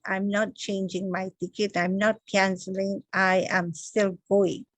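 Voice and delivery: ordinary talk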